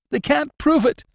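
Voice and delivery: accused prisoner gasping his defence; gasped. A narrator's reading. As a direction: gasp it out in panic. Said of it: "gasped the prisoner".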